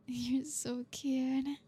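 normal talking voice

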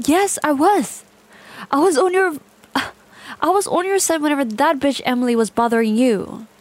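loud, rising, unhinged rant; obsessive outburst. Acted. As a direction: burst out defending your devotion.

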